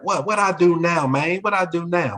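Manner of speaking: startled student. Retold or imitated. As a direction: play the kid caught off guard, voice up high. Student asks why he's called.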